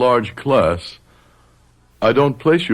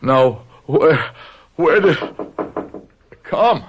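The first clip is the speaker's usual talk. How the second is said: the pain cuts off each phrase; in sudden pain; 6 semitones higher, slower than usual. Acted. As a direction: fight the words out through a stab of pain. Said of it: "the pain struck again".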